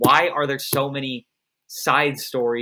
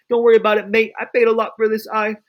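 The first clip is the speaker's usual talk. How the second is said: put-on loud, higher-pitched British accent; 8 semitones higher, 7 dB louder, faster than usual. Guy with the eye reassuring Boba Fett, British accent. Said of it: "he has a British accent for some reason".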